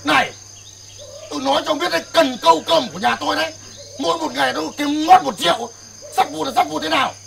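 offended boatman snaps back at rich friend; indignant outburst. Acted. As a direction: defend your livelihood angrily. Boatman angered by scrap insult.

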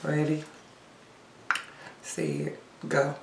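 ordinary talk, nothing marked

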